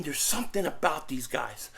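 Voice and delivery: a soft, secret whisper. Real, not acted. Fan confiding his hunch to viewers, whispered. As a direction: lean in and whisper it.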